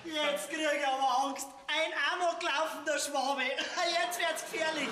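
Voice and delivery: slow, mocking pretend fright; mock fear. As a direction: tease him by faking fear. Spöttische Angst vor Schwaben. (spöttisch gespielte Angst, langsam)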